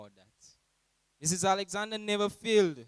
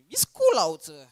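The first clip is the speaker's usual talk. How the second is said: high, loud put-on teasing boy voice; imitating Jalil; 4 semitones higher, 12 dB louder than usual. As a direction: ham up the cheeky reply. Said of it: "That's his lying self".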